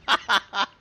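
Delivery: high, loud mocking cackle at the enemy; mocking laugh. Real, not acted. Taunting enemy ship with laughter.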